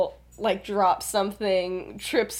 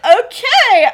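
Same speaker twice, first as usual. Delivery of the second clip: loud, high "okay"; mock over-loud announcement; abrupt interruption; 11 semitones higher, 11 dB louder than usual. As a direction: cut off the awkwardness loudly. Remus cutting off awkward moment.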